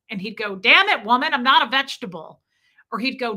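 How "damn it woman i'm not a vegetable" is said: loud, higher mock-exasperated protest; imitated annoyance. Man mad at his overprotective partner, mock exasperation. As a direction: imitate his irritated outburst, a touch hammed-up. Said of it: "He'd get mad at me".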